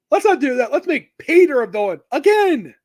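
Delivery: mock the writers' idea with scorn; sarcastic.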